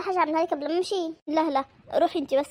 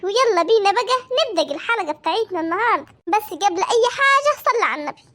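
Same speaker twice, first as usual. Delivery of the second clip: loud, higher host greeting; big, projected YouTube welcome; upbeat channel intro; 4 semitones higher, 6 dB louder than usual. Acted. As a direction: greet viewers and rally them for likes.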